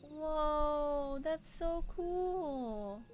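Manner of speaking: ordinary delivery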